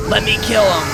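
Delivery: raring for the fight; excited retold outburst. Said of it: "I was so fucking excited".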